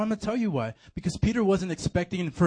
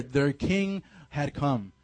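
ordinary talk

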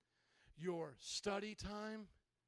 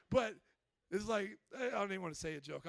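ordinary talk

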